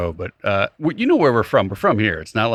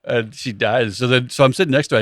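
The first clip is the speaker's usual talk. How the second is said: usual speaking voice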